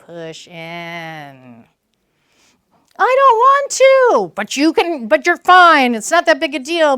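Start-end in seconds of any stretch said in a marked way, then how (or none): 3.0-4.3 much higher, louder whiny protest; mock scared kid balking; scared child. Child refusing to go to school, whiny. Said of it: "child resisting".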